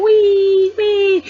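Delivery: very high, loud gleeful wee; child's playful squeal. Retold or imitated. Kai circling on his bike, squealing gleefully, very high. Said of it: "I could just hear Kai going".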